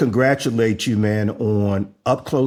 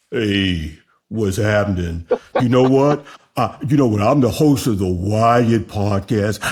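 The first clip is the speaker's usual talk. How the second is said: lower, lightly deepened bedroom voice; imitating guest's bedroom voice; 5 semitones lower than usual. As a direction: mimic the guest's low sexy voice. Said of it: "Let me see if I can imitate you".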